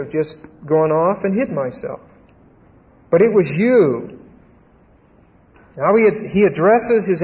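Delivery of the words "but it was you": slow, with weight landing on "you"; emphatic, accusing "you"; stress on YOU. Acted. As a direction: lean hard on "you", drawn out. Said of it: "Very emphatically. The "you" is emphatic".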